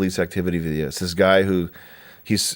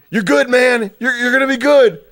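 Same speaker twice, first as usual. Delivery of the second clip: much higher, louder mock woman's voice; high-pitched female voice; 6 dB louder than usual. Mocking the cop's movie lines, high-pitched and louder. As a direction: squeak it like a panicked movie cop. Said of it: "In, like, a high-pitched fucking female voice".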